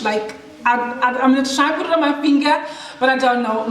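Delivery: ordinary talk, nothing marked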